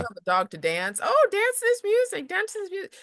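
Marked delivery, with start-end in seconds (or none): 1.0-2.9 high, loud, eager command; excited command. Acted. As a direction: call out brightly and much higher. Acting the command to the dog.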